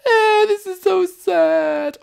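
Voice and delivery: very high, slow falsetto whine; mock crying wail. Princess weeping over betrayal, hammed-up high wail.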